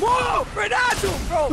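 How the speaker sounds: high, loud furious yell at a teammate; angry shout. Real, not acted. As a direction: blast him for getting you killed. Teammate's grenade kills him.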